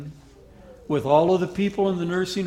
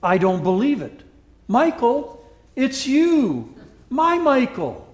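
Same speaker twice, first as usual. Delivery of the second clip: higher and louder, overwhelmed with joy; old woman recognising her lost love, surprised and louder; gasping disbelief; 8 semitones higher, 7 dB louder than usual. Retold or imitated. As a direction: cry out in joyful shock. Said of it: "She gasped".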